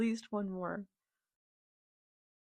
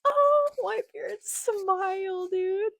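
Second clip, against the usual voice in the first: delighted squeal; 11 semitones higher, 5 dB louder than usual. Real, not acted. Delighted reaction to a panel.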